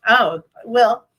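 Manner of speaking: ordinary delivery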